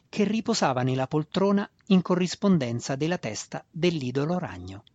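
usual speaking voice

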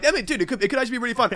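imitating a pal's excited phone call; mock excited friend. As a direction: sell it like a friend who smells a good deal. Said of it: "there was an air in your voice".

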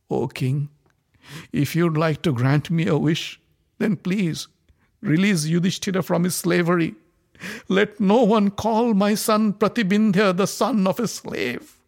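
queen petitioning the blind king; pleading Draupadi. A narrator's reading. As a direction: beg the king for her husband.

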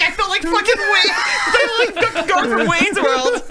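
high laughing mock outburst; laughing retold complaint. Co-host retelling his abandoned-on-air panic, high and laughing. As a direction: crack up while quoting him. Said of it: "he's like".

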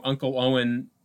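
normal talking voice

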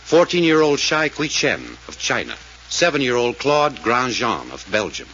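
earnest announcer appeal, measured; radio appeal read. Acted. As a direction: plead with listeners like an old radio announcer. Sponsor appeal before the show.

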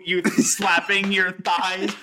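ordinary talk, nothing marked

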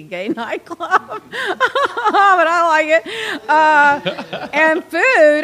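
laughing, high and loud; cracking up while recommending the club. Real, not acted. Laughs about a sleazy club.

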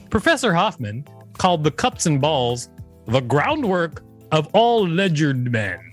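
ordinary talk

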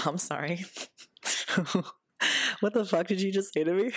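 incredulous, through laughter; affronted but amused; laughing disbelief. Retold or imitated. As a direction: laugh in mock shock at the insult. Stunned reply to being insulted.

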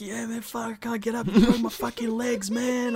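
high, pained mock voice; injured player voice.

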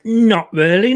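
ordinary talk

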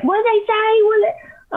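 hammed-up puzzled middle-aged lady; imitating the lady. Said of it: "she's like".

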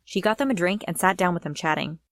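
ordinary delivery, nothing marked

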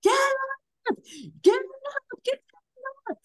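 very high-pitched screamed command; screaming. Retold or imitated. Waking the cheating partner in bed, screaming. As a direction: scream it at the top of your range. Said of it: "I was screaming".

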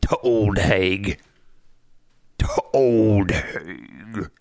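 put-on very slow, ominous old villager; old pub local. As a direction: draw it out like a ghost story warning. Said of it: "and they'd be like".

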